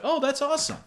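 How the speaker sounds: high-pitched, delighted approval; a bright-eyed, high little cheer; friendly enthusiasm. Acted. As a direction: react like a pleased fellow fan. Acts a friendly approving reply.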